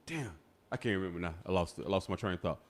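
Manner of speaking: ordinary talk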